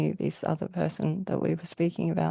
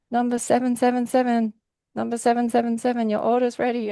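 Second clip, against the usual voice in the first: higher-pitched counter call; order call-out; 4 semitones higher than usual. Retold or imitated. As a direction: call out an order number to waiting customers. Counter worker calling out order number.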